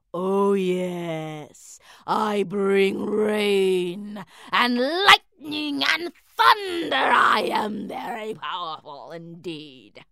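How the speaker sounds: slow booming storm cloud. A narrator's reading.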